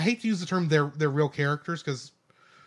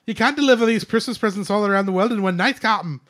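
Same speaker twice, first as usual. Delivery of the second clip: loud, higher Scottish engineer; Scotty; 5 semitones higher, 8 dB louder than usual. Acted. Engineer protesting Christmas deliveries to captain, hammed-up Scottish. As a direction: object urgently in a broad Scottish accent. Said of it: "my Scotty from Star Trek".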